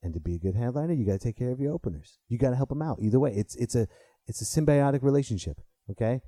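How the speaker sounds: ordinary talk, nothing marked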